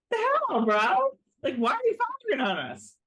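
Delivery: high, incredulous protest; mock indignation. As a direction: imitate someone baffled at being fired on. Acted indignant reaction to being fired on.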